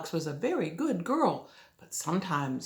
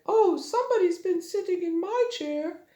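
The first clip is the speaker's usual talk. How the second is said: higher, sweeter mama bear voice; gentle mother bear noticing; 11 semitones higher than usual. Acted. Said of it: "Mama Bear said".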